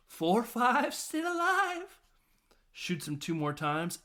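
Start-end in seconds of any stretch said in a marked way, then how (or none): 0.1-2.0 high, rising sing-song voice; mock Catwoman taunt. Catwoman counting lives after being shot, high mock taunt. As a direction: climb higher with each word, stretching the last. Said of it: "She goes".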